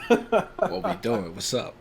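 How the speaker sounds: excited. Retold or imitated. Retold bar quote, hammed up.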